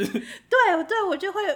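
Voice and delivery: high laughing agreement; giggling yes. Real, not acted. Agreeing with her friend, laughing.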